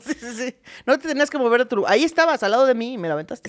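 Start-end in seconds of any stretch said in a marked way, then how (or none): 0.8-2.9 reproche rápido y agudo; regaña de corrido, fingiendo indignación; reclamo indignado. Acted. Sigue el reclamo actuado. (reproche exagerado)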